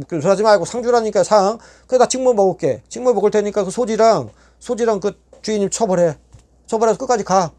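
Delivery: 높은 목소리로 물러서지 않는 요구; 고집스럽게 밀어붙이기; 끝까지 우기기. Retold or imitated. 끝까지 상 달라고 우김. (교도소에서 높은 목소리로 끝까지 처벌 요구)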